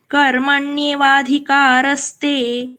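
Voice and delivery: slow कर्मण्येवाधिकारस्ते then a pause; pause after eight syllables. Shown as a demonstration. Teacher shows the pause after eight syllables. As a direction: say it slowly and stop after. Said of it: "यहाँ पे pause".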